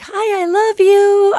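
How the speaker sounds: very high, slow cutesy puppy voice. Pretend. Voicing a puppy begging to be loved, in a high cute voice. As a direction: coo it like a tiny puppy. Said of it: "when these babies are like".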